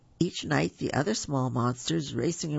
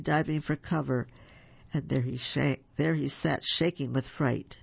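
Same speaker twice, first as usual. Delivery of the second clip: usual speaking voice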